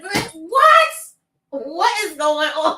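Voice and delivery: loud, high burst of disbelief, then slowing down; stunned outcry slowing into a drawn-out question; shocked disbelief. Retold or imitated. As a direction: blurt it out like you can't believe your ears. Reacting in disbelief to the scene.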